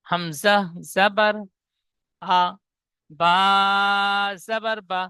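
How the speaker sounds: slow chant of জাবার বা; fatha recitation drill. Shown as a demonstration. Teacher models জাবার বা chant for the class. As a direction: spell out জাবার বা syllable by syllable. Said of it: "ঠিক এমন জাবার লাগিয়ে প্রত্যেকটা পড়া পড়ব".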